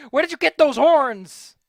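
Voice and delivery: high, loud, slow shouted question; drawn-out incredulous shout; mock outraged yell. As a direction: yell it at the drawing in mock disbelief. Mock outrage at king's horns.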